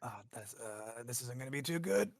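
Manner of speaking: ordinary delivery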